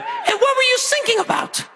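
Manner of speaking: very high imitated child's voice; squeaky mock kid piping up; kid from back seat. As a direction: pipe up like his young son. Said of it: "from the back seat, I heard".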